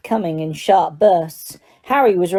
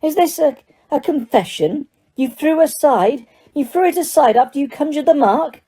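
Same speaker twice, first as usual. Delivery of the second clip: high-pitched, incredulous questioning; disbelief; 8 semitones higher than usual. A narrator's reading. Diggory suspects Harry is confessing, disbelieving. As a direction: pounce on the supposed confession. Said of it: "in disbelief".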